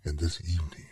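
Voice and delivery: ordinary talk, nothing marked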